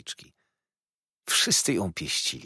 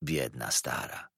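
low, slow, soft mutter; quiet, pitying aside; muttered sympathy; 8 semitones lower, 7 dB quieter, slower than usual. A narrator's reading. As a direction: murmur it slowly under the breath. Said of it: "mrucząc".

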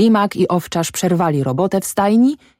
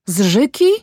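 higher, startled question; alarmed cry; 4 semitones higher than usual. A narrator's reading. Mother demands where he was found, higher alarmed cry. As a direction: ask in shocked disbelief. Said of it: "zakrzyczała kobieta".

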